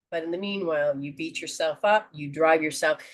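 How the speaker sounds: ordinary delivery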